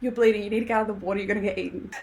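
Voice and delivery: fast, urgent inner panic; racing through the thought of being eaten; rushed panicky thought. Retold or imitated. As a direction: gabble it out in a rush of alarm. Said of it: "It was".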